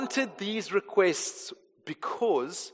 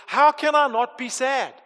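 louder, higher heartfelt plea; impassioned question; 7 semitones higher, 6 dB louder than usual. Acted. Preacher voicing a heart stirred by God, impassioned and raised. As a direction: ask it with mild acted anguish.

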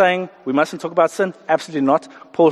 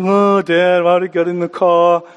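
mock kids' whine; 4 dB louder than usual. Kids grumble to Dad about the car, mock whining. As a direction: drag it out in a put-on whine.

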